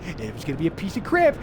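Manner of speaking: usual speaking voice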